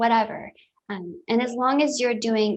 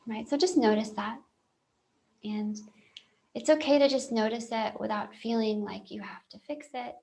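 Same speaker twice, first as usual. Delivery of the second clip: guided meditation. Real, not acted. Telling listeners it's okay to just notice, calm guided meditation. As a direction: talk them gently through noticing, with long silences.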